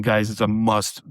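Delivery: ordinary talk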